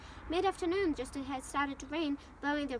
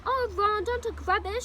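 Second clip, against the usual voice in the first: higher, louder indignant retort; snapped retort; 6 semitones higher, 9 dB louder, slower than usual. A narrator's reading. Hermione scolds Ron for talking rubbish, snapped. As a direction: cut him off sharply, voice raised. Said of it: "snapped Hermione".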